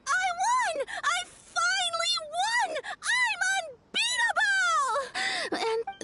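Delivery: imitated triumphant yelling. Retelling Ito's rare win at kids' games, gleeful mock yelling. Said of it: "started jumping around and yelling".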